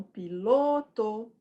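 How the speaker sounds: piloto with LO drawn out; slow called piloto, lingering on LO; long stressed syllable. Shown as a demonstration. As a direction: stretch the LO in piloto. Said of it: "A sílaba mais longa".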